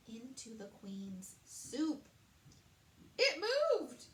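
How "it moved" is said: much higher, louder startled cry; queen jolting at a shock; startled exclamation. A narrator's reading. Queen to the chef, startled by her moving soup. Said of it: "the queen exclaimed".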